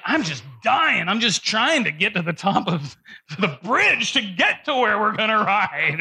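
strained, climbing voice breaking into a laugh; hammed-up exhausted effort; mock struggling. Acts out straining up the bridge.

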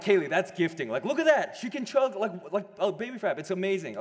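high, loud, delighted gushing; thrilled proud exclaiming; amazed gushing. Retold or imitated. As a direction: rave to Kaylee about her daughter's chugging. Marvelling at toddler chugging drink.

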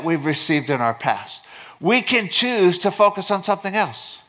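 high-pitched, emphatic preacher delivery; impassioned preaching. Real, not acted. Pastor telling congregation they can choose their focus, impassioned preaching. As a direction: drive each point home with fervor.

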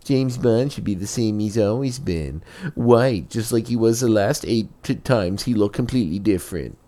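high, mocking whine; mock whiny fanboy. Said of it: "people whining and crying".

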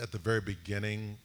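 ordinary talk

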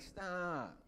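high, whiny plea to be left alone; pouty. Retold or imitated. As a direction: whine it like a kid who won't fight back. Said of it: "you know, just really pouty".

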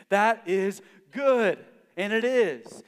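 excited praise; enthusiastic. Acted. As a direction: rave about it. Enthusing about drum solo.

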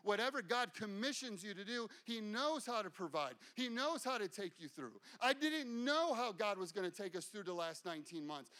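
usual speaking voice

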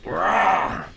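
loud high dinosaur roar; playful monster growl. Pretend. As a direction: roar it out like a T-Rex. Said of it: "let's get your best dinosaur impression".

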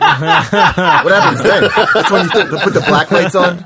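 high, loud laughing question. Real, not acted. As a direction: tease through laughter. Teasing joke, laughing.

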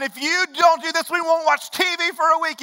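threatening parent. Retold or imitated. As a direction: lay down the TV ban. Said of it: "And you start threatening".

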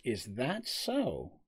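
drawn-out, soft and amused; smug slow reply. Acted. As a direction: let the question hang, unbothered.

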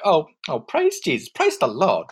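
mock Southern churchgoer exclaiming; Southern praise. Imitating Savannah locals, mild Southern gushing. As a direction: gush it like a cliché Georgia local. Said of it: "they would always be like".

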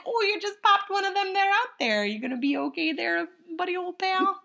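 high, hammed-up Minnesota twang; Fargo accent. Imitating the Fargo-accented bat sidekick for a friend, in a high Minnesota accent. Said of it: "his accent is so weird".

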